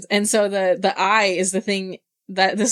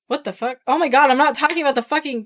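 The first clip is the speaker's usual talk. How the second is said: retold exasperated outburst, high and louder; angry outburst; 6 semitones higher, 4 dB louder, faster than usual. Ig cuts Lee off about Glenna, angry and exasperated. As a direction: rush it out high, imitating someone losing his temper. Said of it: "getting all angry".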